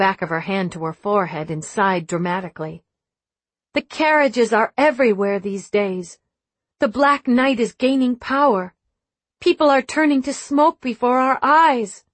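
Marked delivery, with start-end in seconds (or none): 3.7-12.0 dramatic princess. Pretend. Meredith playing a tragic princess.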